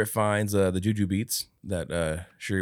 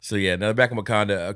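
normal talking voice

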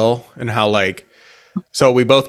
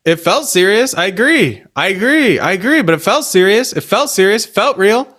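rising insistence; 8 semitones higher than usual. Pretend. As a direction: voice climbing higher and higher.

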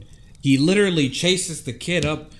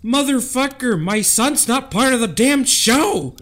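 furious mom; 11 semitones higher, 5 dB louder than usual. Retold or imitated. Mom yelling at the cop about her son, outraged shout. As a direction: blow up at the cop like a furious mother. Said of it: "in my mind, it's like".

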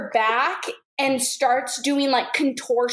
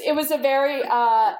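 ordinary talk

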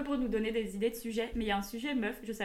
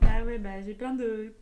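usual speaking voice

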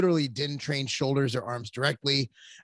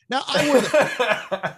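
laughing; 8 semitones higher, 5 dB louder, much slower than usual. Real, not acted. Joking about who rules home.

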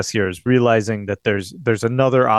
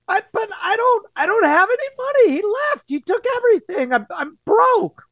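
much higher, breaking pleas; tearful pleading. Acted. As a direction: beg through tears that she has nothing. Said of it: "just crying back at him".

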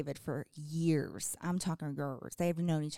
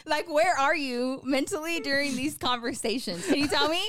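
mock exasperated; 6 semitones higher, 4 dB louder than usual. Real, not acted. Teasing husband for not listening.

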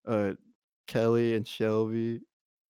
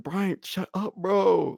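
joking hush; 8 semitones higher, 3 dB louder, slower than usual. Retold or imitated. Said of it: "Like, jokingly".

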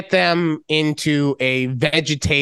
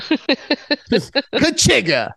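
drawn out, higher catchphrase; Lightning-style ka-chigga; mock Cars racer catchphrase; 8 semitones higher, much slower than usual. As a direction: stretch it slowly like a cartoon race car. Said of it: "Do you know what movie that's from?".

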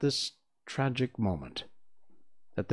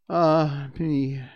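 high, loud lament; raised cry of woe; 6 semitones higher, 8 dB louder than usual. A narrator's reading. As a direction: wail it mildly, as in mourning. Voices lamenting at the news.

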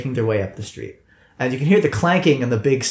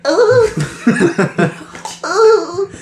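high squeaky voice; 5 dB louder than usual. Acted. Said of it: "Commissar Cute's like".

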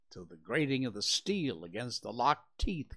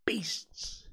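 forced out on an unvoiced breath, pitched up; breathless exclamation; 8 semitones higher, 3 dB quieter than usual. A narrator's reading. Scott straining to pry the dogs apart, gasped out on a breath. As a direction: spit it out mid-strain as the breath leaves. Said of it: "exclaiming with every expulsion of breath".

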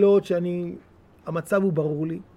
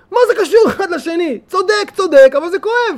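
higher and louder, pressing the pain; impassioned; 8 semitones higher, 6 dB louder than usual. Real, not acted. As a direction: cry out that it hurts. Preacher impassioned about pain.

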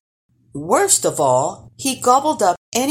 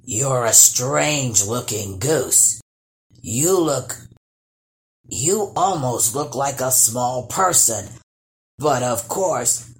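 grunting troll; 5 semitones lower than usual. Acted. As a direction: grunt it out while sniffing her over. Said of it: "he grunted".

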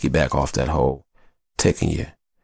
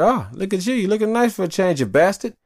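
teasing compliment. Retold or imitated.

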